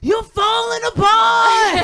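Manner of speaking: high, drawn-out full shout; mock alarm. Comedian mother scaring son about lost tooth, mock-alarmed shout. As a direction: shout it like a hammed-up catastrophe.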